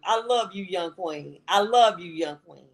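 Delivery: ordinary delivery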